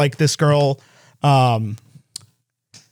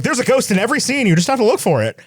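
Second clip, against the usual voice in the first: teasing friend; 8 semitones higher, faster than usual. Retold or imitated. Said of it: "Mary Clay in the group chat".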